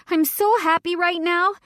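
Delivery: high, loud, gushing joy; happy toast. Acted. Tipsy girl toasting her best friend, gushing and loud. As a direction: raise a glass and gush.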